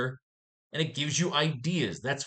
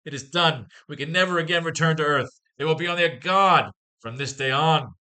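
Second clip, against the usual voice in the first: loud, punchy, higher comic book read-aloud; dramatic comic reading; 4 semitones higher, 6 dB louder than usual. Acted. Reading an old Spider-Man comic aloud to viewers, loud and dramatic. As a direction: belt out the panels like an old radio serial.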